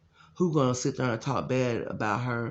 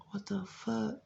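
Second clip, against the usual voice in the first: high, hushed what-the-fuck; soft, high-pitched bewildered aside; retold disbelief; 10 semitones higher, 9 dB quieter, slower than usual. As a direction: act out stunned disbelief under your breath. Retold stunned reaction to what happened.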